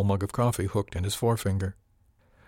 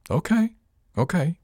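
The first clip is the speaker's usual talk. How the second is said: placating backing off; 9 semitones higher, 3 dB louder than usual. Acted.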